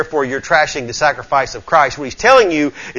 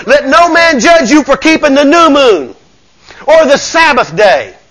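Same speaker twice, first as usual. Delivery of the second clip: high, loud scripture proclamation; full-force preaching; 10 semitones higher, 8 dB louder than usual. Real, not acted. Preacher quoting the verse on new moon and Sabbath, proclaiming it. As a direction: ring the verse out to the congregation.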